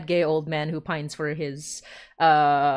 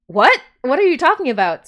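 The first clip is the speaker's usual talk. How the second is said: loud, somewhat higher mock astonishment; retold disbelief; 7 semitones higher, 9 dB louder than usual. Host retelling the coincidence, loud mock astonishment. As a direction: replay the shock. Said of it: "I was like".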